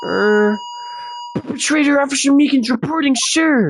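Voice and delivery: high, formal-sounding report; flustered eager officer. Acted. Officer confessing himself as perpetrator, eager and high.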